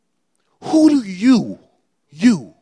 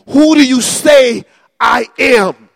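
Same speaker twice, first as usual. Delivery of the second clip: shouted, louder and higher; preaching shout; 5 semitones higher, 10 dB louder than usual. Real, not acted. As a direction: cry it out to the whole church. Preacher crying out to congregation.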